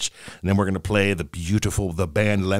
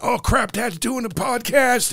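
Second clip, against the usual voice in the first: son's reaction; 11 semitones higher, slower than usual. Retold or imitated. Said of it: "He went".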